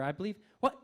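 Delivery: ordinary talk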